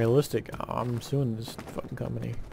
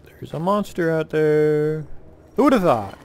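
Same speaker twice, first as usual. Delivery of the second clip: sarcastic mock surprise; 3 semitones higher, 7 dB louder than usual. Real, not acted. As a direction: act shocked at the obvious, mockingly. Sarcastic reaction to obvious monster.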